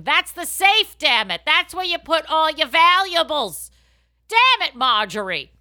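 loud exasperated shout; hammed-up angry shipboard yell; mock yell. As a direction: bark at Marjorie in mock panic. Mock ship scene yelling.

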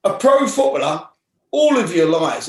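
retold astonished warden; imitating the warden's amazement. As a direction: sell the disbelief, as if it tops everything.

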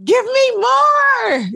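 hammed-up demand; mock demanding shout. Craving more episodes.

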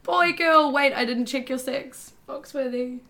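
high, loud pet talk; high doting voice to the animal; cooing to horse. Acted. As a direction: gush over the horse like a puppy. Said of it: "And I'm going".

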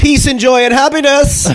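high, slow, sing-song through a laugh; sing-song joke. Pretend. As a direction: chirp it like a greeting card. Jokey wish list answer.

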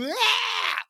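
high-pitched demonstrative "ah" cry; hyperbolized stage yell. Shown as a demonstration. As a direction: let out an exaggerated stage yell. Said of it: "talking like this and going".

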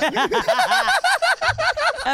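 high-pitched wheezing laugh; high squealing laugh. Real, not acted. Laughs at his own story.

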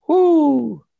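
high joyful whoop; excited whoop. Real, not acted. Speaker firing up the audience, a high excited whoop. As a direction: let out a big excited whoop. Crowd-pumping cheer in talk.

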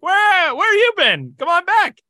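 high excited dog voice. Retold or imitated. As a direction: voice the dog calling him back, high. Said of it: "she was barking at him".

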